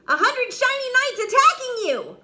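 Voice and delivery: high, loud, fast jump-scare cry; spring it on him to startle; sudden shout. A narrator's reading. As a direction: knight yells to frighten the dragon into fire. Sudden scare to trigger fire.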